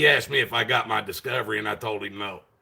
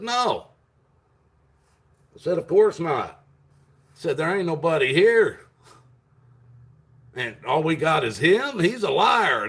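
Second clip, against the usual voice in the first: high, loud retold protest; indignant refusal; 7 semitones higher, 5 dB louder than usual. Defendant refusing trial to the prosecutor, indignant. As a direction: push it up in pitch, outraged.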